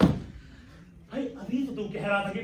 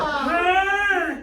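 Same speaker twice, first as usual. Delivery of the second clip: long drawn-out yell of delight; joyful shout; 7 semitones higher, 8 dB louder, much slower than usual. Acted. Father cheers on hearing the wedding is fixed, a long happy shout. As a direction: shout it out with glee.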